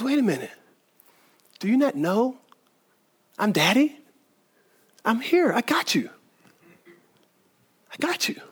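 soft, hushed fatherly comfort, fading to a whisper; calm a frightened child in a hush; tender reassurance. Retold or imitated. God comforting a nervous believer, soft and tender.

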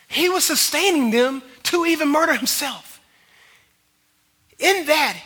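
fervent sermon crescendo; impassioned preaching. Real, not acted. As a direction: drive it home to the congregation, loud. Preaches at full force.

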